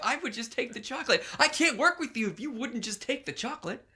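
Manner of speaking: high-pitched incredulous arguing; acted indignant protest. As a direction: protest it like an affronted schemer. Acted quarrel, voicing Louise's objection.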